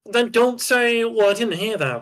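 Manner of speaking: high, heated scolding; angry yelling. Real, not acted. Player rejecting teammate's excuse, angry yelling. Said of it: "Stop yelling at me".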